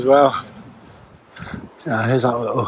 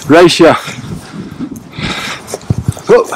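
much louder playful challenge; playful shout; 4 semitones higher, 7 dB louder than usual. Real, not acted. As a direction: dare the dog to a race. Challenging the dog to race.

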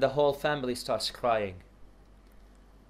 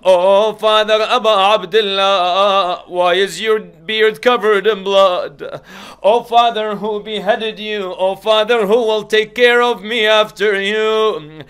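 loud grieving cry, higher; 7 semitones higher, 14 dB louder, slower than usual. Acted. Girl crying over her father's head, loud and grieving. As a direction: plead to the dead father. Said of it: "and cried".

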